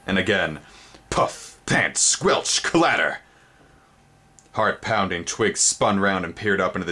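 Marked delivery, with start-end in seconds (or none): 1.1-3.2 sound effects. A narrator's reading. Sound effects read aloud.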